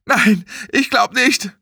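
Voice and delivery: high, slow, distressed answer; panicked realisation. Acted. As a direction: say it in rising dread. Stellt fest, dass er nicht atmet. (entsetzt und verzweifelt)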